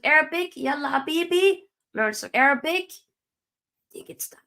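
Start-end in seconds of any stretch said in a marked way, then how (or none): 2.9-4.4 whispered farewell. Acted. Dramatic farewell to Casemiro.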